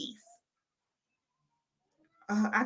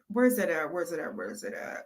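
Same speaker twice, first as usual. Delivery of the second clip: fading mutter; 4 semitones lower, 8 dB quieter than usual. Real, not acted.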